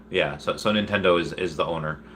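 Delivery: normal talking voice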